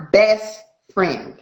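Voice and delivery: ordinary talk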